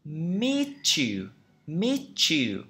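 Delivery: Meet you run together as "mee-chew"; linked "ch" sound. Shown as a demonstration. Teacher showing learners linking, Meet you said as "mee-chew". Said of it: "Nó sẽ trở thành âm ch".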